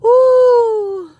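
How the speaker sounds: high loud ooh after laughing; big exhale. Real, not acted. Streamer recovering from a big laugh. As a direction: let out a long ooh.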